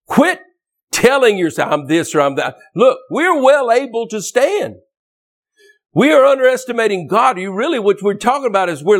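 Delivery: fired-up pulpit cry; rising exhortation. Real, not acted. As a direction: lift it up and drive it home.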